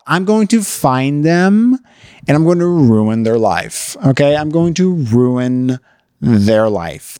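slow, menacing promise dropping low; hammed-up loyal friend swearing revenge; mock vengeful vow. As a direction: draw out the threat, sinking deep. Acted friend vows revenge for her.

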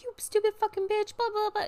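high-pitched pretend ranting at a driver; mock road rage. Driver re-enacting outbursts during a phone call, mock road rage.

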